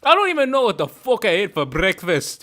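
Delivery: loud, indignant Scottish rant; hammed-up Scots fury; angry Scottish accent. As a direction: square up like an irate Scotsman. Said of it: "hearing a fucking angry Scottish person".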